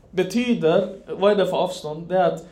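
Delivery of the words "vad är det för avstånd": normal talking voice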